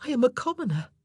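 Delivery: high-pitched humble protest; overwhelmed young woman. A narrator's reading. Anne protests her low rank.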